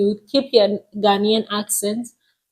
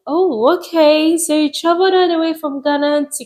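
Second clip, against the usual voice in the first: higher and faster, lightly mocking amazement; imitated surprised driver; 6 semitones higher than usual. Uber driver amazed she came from Ghana, mock surprise. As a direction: play the driver who can't believe how far she came.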